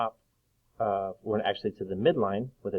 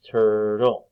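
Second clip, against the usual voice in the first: "turtle" loud, in two even beats; split into syllables; 5 dB louder than usual. Shown as a demonstration. Teacher clapping syllables for children, "turtle" split in two. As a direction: beat out both parts of "turtle". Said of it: "clap out the different parts".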